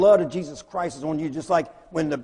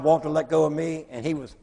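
usual speaking voice

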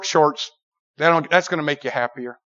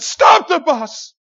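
high, slow, loud drawn-out command; a stretched loud yell to stop the bus; shout; 11 semitones higher, 6 dB louder, much slower than usual. Retold or imitated. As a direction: drag it out so the driver slams on the brakes. Yells at driver to stop.

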